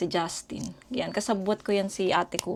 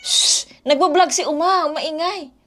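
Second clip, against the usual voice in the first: hushing the dog; 9 semitones higher, 5 dB louder than usual. Real, not acted.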